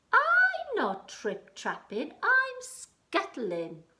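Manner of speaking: high, slow little creature voice; tiny spider voice. Acted. Spider answering the troll, small and high.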